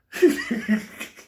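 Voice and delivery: high, loud wheezing laugh; helpless laugh. Real, not acted. Storyteller loses it over the midnight wait, wheezing laugh. Teller cracks up at his story.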